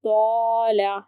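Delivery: तौला with a full, heavy "tau", mouth filled. Shown as a demonstration. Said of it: "ताला नहीं पढ़ेंगे".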